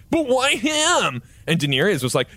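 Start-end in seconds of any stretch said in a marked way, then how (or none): 0.1-1.2 whiny Harry. Acted. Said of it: "Stupid whiny Harry Potter was like".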